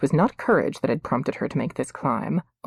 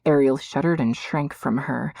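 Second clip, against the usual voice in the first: plain reading voice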